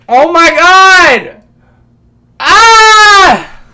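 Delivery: shrieking high oh my God then a cry; loud outburst. Real, not acted. Sudden outburst mid-rant.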